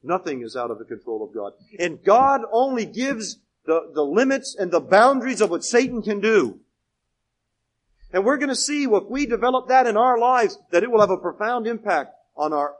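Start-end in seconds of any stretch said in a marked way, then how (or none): 1.8-12.8 loud sermon delivery with punched key words; emphatic preaching. Real, not acted. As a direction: drive the point home to the congregation. Preaches at full force.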